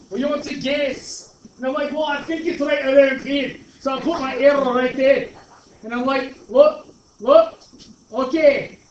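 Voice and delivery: Shrek. Pretend. Teacher doing a requested voice for students over calculator steps, hammed-up ogre. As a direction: play up a blustering ogre while explaining. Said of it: "Can you do your Shrek voice?".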